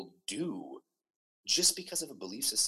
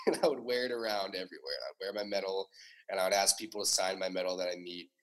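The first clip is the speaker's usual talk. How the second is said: usual speaking voice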